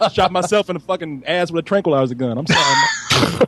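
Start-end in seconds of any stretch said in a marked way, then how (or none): 2.4-3.4 high shouted apology; yelled apology. Retold or imitated. Joking about tranquilizing himself, a high shouted sorry to mom.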